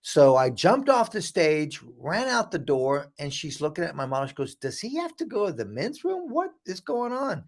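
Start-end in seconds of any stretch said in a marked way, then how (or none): 4.5-7.5 retold in a much higher, puzzled voice; baffled questioning. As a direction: act out a bewildered onlooker. Evaluator baffled by the model leaving.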